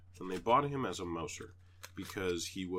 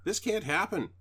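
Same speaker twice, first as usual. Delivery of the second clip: higher, louder, firm; firm telling off; 10 semitones higher, 5 dB louder than usual. Retold or imitated. Telling the stray cat firmly that this can't happen. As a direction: lay down the law to the cat. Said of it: "I explain again".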